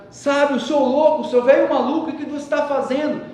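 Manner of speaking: high, loud panicked cry for help; desperate yelling. Retold or imitated. Boy being held underwater yells at the old sage, panicked and loud. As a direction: scream at him like you're drowning.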